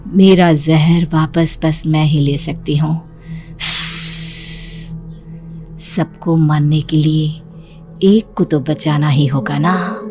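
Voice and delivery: slow, deliberate menace; sinister. Acted. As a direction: savour each word like a secret villain. Snake girl secretly speaks, sinister.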